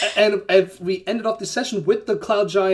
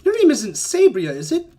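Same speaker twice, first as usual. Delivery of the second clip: high, loud, inquisitive giant; jovial giant; 5 semitones higher, 4 dB louder than usual. Acted. Giant looking up from his book at the half-elf, high and loud. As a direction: pounce on the question with a high lift. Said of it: "a little higher pitched than you expected".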